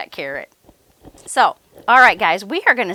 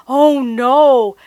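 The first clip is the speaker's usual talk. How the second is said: gasped; 2 semitones higher, 7 dB louder than usual. Acted. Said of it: "gasped Big Bob".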